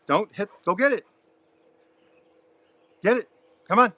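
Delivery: excited command to fetch; excited urging. Real, not acted. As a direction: pump the dog up to fetch.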